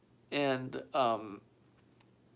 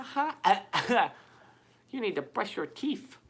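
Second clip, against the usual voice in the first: ordinary talk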